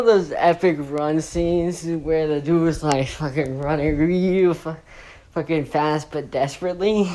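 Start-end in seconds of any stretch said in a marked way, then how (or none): none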